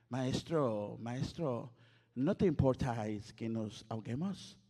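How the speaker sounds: lightly voiced Castilian vosotros reading; a hint of formal Spain Spanish; mock Spain Spanish. Shown as a demonstration. Preacher mocking stiff Spain Spanish Bible reading, mild Castilian lilt. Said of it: "Así como bien español".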